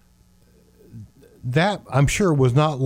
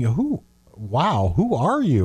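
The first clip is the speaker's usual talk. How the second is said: incredulous; slower than usual. Acted. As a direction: play astonished disbelief, lifting the pitch. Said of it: "some incredulously saying".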